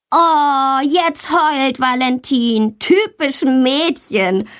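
high, drawn-out taunt; jeering call. A narrator's reading. Tommy taunts Valentina for crying, calling out. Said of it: "ruft Tommy".

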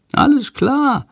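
high, chirpy bird voice; very high cheeky bird agreeing; Shandor's reply. A narrator's reading. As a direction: chirp it back, ready. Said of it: "antwortet Shandor".

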